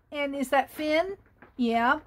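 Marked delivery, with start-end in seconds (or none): none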